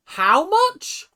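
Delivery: shrieking disbelief. Real, not acted. Repeating the price to the show, a shocked shriek. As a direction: shriek it like the number can't be real.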